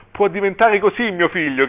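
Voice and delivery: high, distressed exclamation; narrated cry. A narrator's reading. Desperate father answering his wife, crying out. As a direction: start high and protest in dismay.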